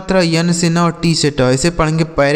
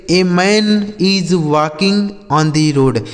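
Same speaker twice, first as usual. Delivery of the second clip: slow example reading; much slower than usual. Shown as a demonstration. Teacher reads example sentence slowly.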